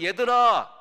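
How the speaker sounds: very loud, high call; preacher belting out Jesus's call to his children. Acted. (크고 높게 외쳐 부르듯)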